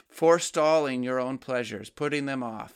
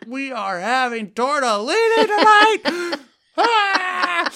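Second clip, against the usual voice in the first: high, loud, laughing mock-tantrum kid voice; child losing their mind; 11 dB louder, slower than usual. Parent imitating a child raving about tortellini, high and loud. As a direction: squeal it like an overexcited child over dinner. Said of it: "I've had this with my own children".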